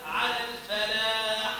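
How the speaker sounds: slow على الفلاح, fāʾ kept thin; light fāʾ. Shown as a demonstration. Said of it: "رقيق الفاء".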